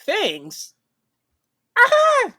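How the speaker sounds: high, loud, shrieking cackle through the words; cackling lady. Retold or imitated. Mocking the lady next to him, high shrieking cackle. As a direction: imitate a woman cackling wildly in the theater. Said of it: "was just cackling the whole time".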